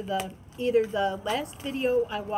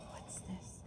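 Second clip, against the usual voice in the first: whispered what's this; 19 dB quieter than usual. Real, not acted. Peering into package.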